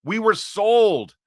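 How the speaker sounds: high, loud, fed-up cry of disbelief; impassioned outburst. Real, not acted. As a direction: let the frustration burst out at full voice. Angry rant on Gaza peace efforts.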